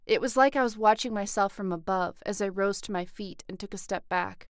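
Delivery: ordinary delivery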